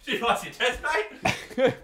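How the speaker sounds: high, loud put-on voice; mock bright question. Acting out what he'd have asked, high mock voice. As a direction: ham up the cheery question.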